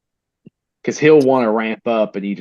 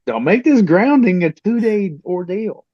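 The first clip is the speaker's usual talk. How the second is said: higher, pleading warning; exasperated warning; 7 semitones higher, 4 dB louder than usual. Retold or imitated. Dad warning his son about the grounding, pleading. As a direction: warn him off, half begging. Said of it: "I'm like".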